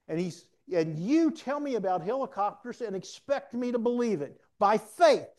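loud, high-pitched imitation of a skeptic; indignant challenge. Supervisor challenges a believer about helicopters, indignant. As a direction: hammer out an incredulous protest as the boss.

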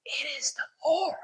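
awed hushed whisper. Acted. The beloved disciple tells Peter it's Jesus, awed whisper. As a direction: breathe it out in wonder.

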